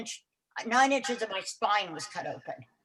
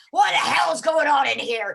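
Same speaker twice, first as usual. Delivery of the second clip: loud, higher-pitched scolding yell; crusty old nurse; 5 semitones higher, 7 dB louder than usual. Retold or imitated. Old nurse bursting in on screaming patient, crusty shout. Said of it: "this old crusty gal".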